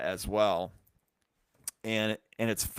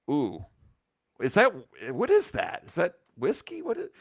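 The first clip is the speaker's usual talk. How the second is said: much higher, intrigued ooh; surprised sniff; 4 semitones higher than usual. Retold or imitated. Smelling the mystery beer, higher surprised guessing. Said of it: "it was like".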